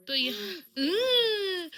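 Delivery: very slow, tearful halt; choked up. Real, not acted. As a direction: voice breaking over the pet. Tearful goodbye to her pet.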